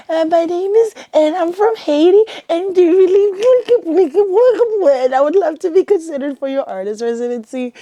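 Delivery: high, loud, jittery imitation of herself meeting her idol; nervous imitation. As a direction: replay your own nervous pitch, voice jumping up high. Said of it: "I was, like, so nervous".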